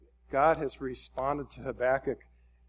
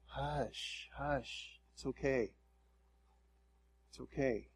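put-on soft, gentle words to a crying baby; hushed soothing; 11 dB quieter, slower than usual. Preacher imitating comfort for a crying baby, hushed and soothing. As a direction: settle a fussing baby, very softly. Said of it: "like you do with a little baby that's crying".

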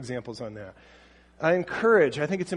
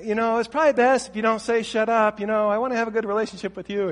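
smooth it over, afraid to upset; gentle placating parent; 6 semitones higher, 4 dB louder than usual. Retold or imitated. Parent tiptoeing around a rude teen, higher placating tone. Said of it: "redirect them real gently".

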